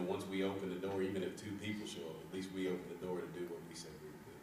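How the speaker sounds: usual speaking voice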